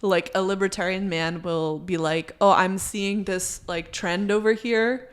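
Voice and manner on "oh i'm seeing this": ordinary delivery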